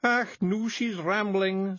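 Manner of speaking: high, thick Scots pixie voice; small tough Scots fairy breaking bad news; Scottish Feegle voice. A narrator's reading. Feegle Rob.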